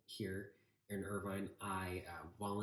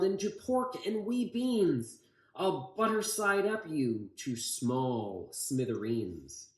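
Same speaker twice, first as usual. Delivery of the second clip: higher storybook yell easing back, slower at the end; yelled threat; 5 semitones higher, 6 dB louder, slower than usual. Acted. Van Itch threatening Grandpa with the bomb, mock yell. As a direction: finish the threat with a villain's relish. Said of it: "he yelled".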